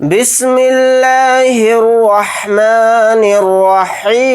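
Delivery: slow melodic tajweed chant; measured Quranic recitation. Shown as a demonstration. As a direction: recite slowly, stretching each vowel. Opens recitation with taawwuz.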